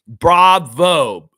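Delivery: loud, exaggerated applause word; mock-congratulate them; sarcastic cheer; sarcastic bravo. Mocking congratulation.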